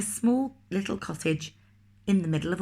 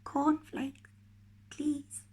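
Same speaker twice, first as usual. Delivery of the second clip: much softer, high small whisper; 8 semitones higher, 7 dB quieter, much slower than usual. Acted. Mister Quiet whispering his order to the grocer, very soft. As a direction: barely breathe the request. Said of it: "he whispered".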